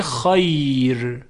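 خیر drawn out, ending on a thin R; light R. Shown as a demonstration. Teacher explaining the exception, light final R in خیر. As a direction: hold the glide of خیر, then a light R. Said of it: "ترقیق".

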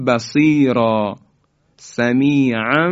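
سمیعاً بصیرا recited slowly, tanwin into m; slow recitation. Shown as a demonstration. Teacher reciting سمیعاً بصیرا with iqlab. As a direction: let سمیعاً flow into بصیرا with m.

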